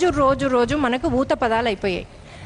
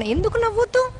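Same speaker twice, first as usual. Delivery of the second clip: high, surprised why not; puzzled question; 9 semitones higher than usual. Retold or imitated. Bride asking her husband why she can't laugh, in high surprised disbelief. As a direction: ask it in sudden disbelief.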